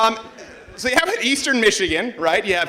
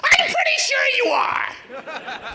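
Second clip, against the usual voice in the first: much higher, slow laughing squeak; mock insistence; much slower than usual. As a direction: squeak it out like a smug know-it-all. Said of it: "mocking leftist replies".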